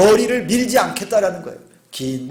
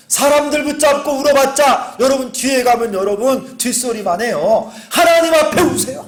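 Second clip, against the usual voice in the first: fervent sermon climax, pitched higher; impassioned preaching; 5 semitones higher than usual. Real, not acted. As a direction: plead with them to cry before God. Preacher exhorting at full force.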